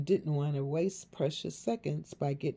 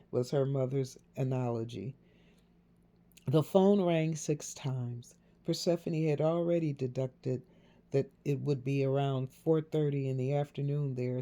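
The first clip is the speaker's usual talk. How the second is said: plain reading voice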